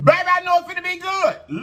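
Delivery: high excited squeal; excited shout. Real, not acted. Excited mukbang start, high shout.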